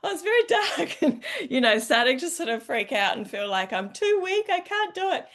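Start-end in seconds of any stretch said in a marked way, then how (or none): 0.0-1.0 mock rising panic. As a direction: act out growing fear on the ladder, half joking. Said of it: "And I'm like".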